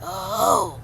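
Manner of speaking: muster every last bit of strength to speak; imitating a feeble granddad struggling to get words out; straining dying granddad. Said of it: "mustered up all the strength that he could to say".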